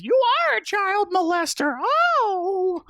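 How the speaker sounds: very high mock-horrified wail; hammed-up falsetto shriek, drawn out at the end; shrieking wife impression. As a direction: imitate an outraged wife's accusation. Acts out wife's shocked accusation.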